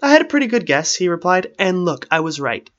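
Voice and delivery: high, loud comeback; defensive retort. A narrator's reading. Strider answers Arwen's yelled doubt, defensively. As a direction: raise the voice in self-defence.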